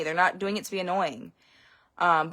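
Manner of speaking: ordinary delivery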